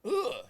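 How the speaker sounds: high-pitched "ugh" of mock disdain; mock grunt. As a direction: grunt it up high, imitating scorn. Mocks how kids get labelled.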